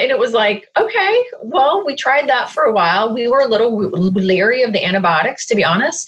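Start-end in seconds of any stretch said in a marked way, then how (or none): 0.8-3.1 doubtful okay. Retold or imitated. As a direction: retell a reluctant, unconvinced reaction. Retold doubtful reaction to advice.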